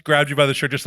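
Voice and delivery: ordinary talk, nothing marked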